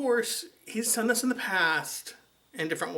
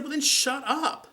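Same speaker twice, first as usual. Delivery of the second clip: high, slow, pointed retort; slow it down and sneer high; mock snap at the ex; snippy shut-up; 10 semitones higher, 4 dB louder, slower than usual. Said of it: "I said it kinda loudly".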